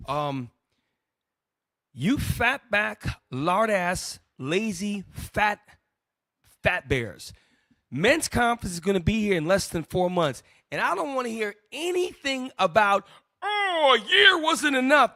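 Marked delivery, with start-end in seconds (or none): 1.9-7.3 loud, slow insulting roast; mock berating. Host roasting his fat listeners, loud mock berating. 10.7-15.1 loud, high mocking whine of an excuse; mock rant. Host mocking excuses of listeners, loud high mock whine.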